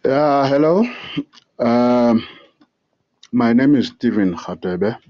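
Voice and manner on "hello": ordinary delivery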